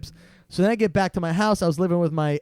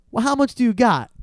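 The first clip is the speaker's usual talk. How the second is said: higher-pitched retold haggling line; lightly acted pushy closer; pushy salesman; 7 semitones higher than usual. Salesman to broke customer, lightly acted pushy haggling.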